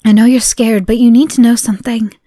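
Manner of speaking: ordinary talk, nothing marked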